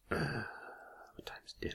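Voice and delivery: slow, faint whisper. Pretend. Idle murmur to co-host in a silent room, whispered. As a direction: breathe it out barely audibly.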